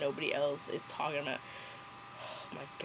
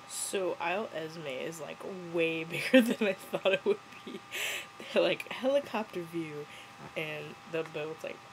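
usual speaking voice

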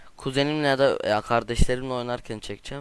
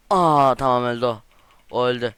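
excited exclaim; 8 dB louder than usual. Real, not acted. Gamer reacts to a kill.

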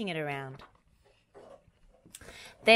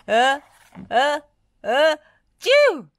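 three high gasps, then a loud achoo; acted sneeze; 10 semitones higher, 11 dB louder, slower than usual. A narrator's reading. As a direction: build up to a big storybook sneeze. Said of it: "sneezed Muck".

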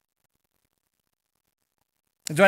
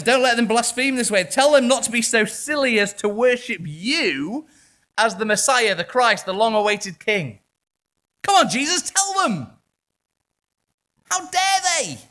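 higher, louder scandalised protest rising to outrage; indignant Pharisees; 8 semitones higher, 6 dB louder than usual. Acted. Preacher voicing the Pharisees demanding Jesus silence his disciples, indignant. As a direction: bristle like an offended religious elite demanding Jesus rebuke the crowd.

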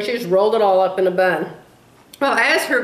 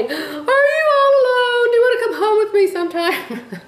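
tender pretend invitation; 12 semitones higher than usual. Imagined words to a darling Walmart granny, high doting coo. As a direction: coo it like you want to take her home. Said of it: "I wanted so much to just go over there and hug her and say".

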